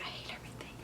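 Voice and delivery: a hushed, miserable whisper under her breath; whispered misery. Retold or imitated. Lost in the dark, hiding tears from her sister, whispered despair. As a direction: whisper it so the little sister won't hear. About to cry, little sister near.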